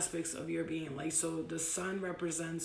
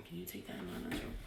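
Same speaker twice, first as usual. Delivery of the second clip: whispered request; 11 dB quieter, much faster than usual. Real, not acted. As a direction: whisper it aside. Mom asks kid during live.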